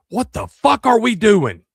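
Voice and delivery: loud, high, drawn-out outburst; mock exasperated yell. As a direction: imitate a kindergartner's outraged yell. Imagines Ace yelling in kindergarten.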